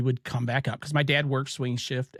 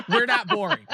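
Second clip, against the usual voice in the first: laughing it out, louder and higher; 10 semitones higher, 6 dB louder than usual. Real, not acted. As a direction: blurt it through real laughter. Joking about listeners yawning.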